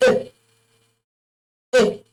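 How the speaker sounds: short, high French E, like 'ay'; French é sound. Shown as a demonstration. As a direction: say E as a crisp acute é. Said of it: "this is how it is pronounced".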